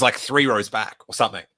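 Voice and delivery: ordinary talk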